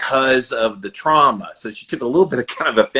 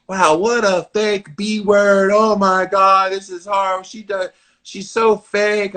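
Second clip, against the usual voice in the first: mock hater; 8 semitones higher than usual. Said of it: "the comments people write".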